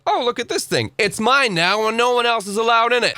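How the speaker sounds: high mock-whiny possessive voice; high, bratty mock voice; mocking childish voice. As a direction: parody a spoilt child claiming a toy. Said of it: "You can't just be like".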